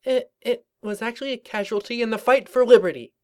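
high, sheepish excuse; embarrassed young mare voice. Acted. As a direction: mumble a grand excuse, embarrassed. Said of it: "in embarrassment".

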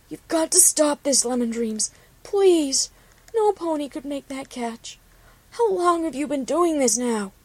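a hint of a worried pony friend begging; pleading friend. A narrator's reading. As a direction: beg her friend to stop, voice rising. Copper pleads with her friend.

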